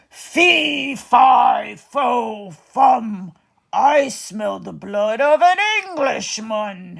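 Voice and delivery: loud giant chant, a shouted fi; hungry giant sniffing the air. Acted. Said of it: "he said".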